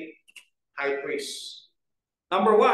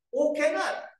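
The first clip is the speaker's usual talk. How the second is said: "cannot" lifted higher; emphatic stress; 6 semitones higher than usual. Shown as a demonstration. Preacher to the congregation, stressing "cannot". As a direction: land on "cannot" with weight. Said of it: "it makes an emphatic".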